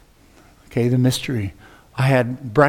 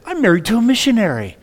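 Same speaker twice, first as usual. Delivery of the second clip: joyful exclaim; 9 semitones higher, 4 dB louder than usual. Retold or imitated. Wife jumps in his lap.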